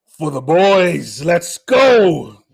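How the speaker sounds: loud, slow, drawn-out booming call; big stretched-out hype shout; deep voice hype. Shown as a demonstration. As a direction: belt it out slowly for the boys. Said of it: "in a deep voice".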